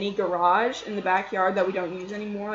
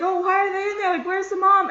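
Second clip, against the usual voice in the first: high, louder worried questions; retold freak-out; 11 semitones higher, 4 dB louder than usual. Storyteller finds kittens shut in a cabinet, acted freak-out. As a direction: replay a fretful freak-out in a raised pitch. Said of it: "So I'm freaking out".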